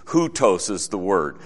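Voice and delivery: usual speaking voice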